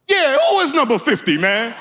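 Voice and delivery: high-pitched feigned outrage; playing along innocently. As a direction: chime in as if it's not your kid. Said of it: "I tried to calm the fathers down".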